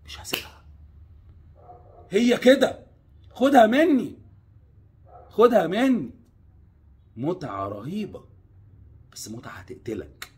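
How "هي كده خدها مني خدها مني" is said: emphatic insistence. Real, not acted. Insists listener take his word.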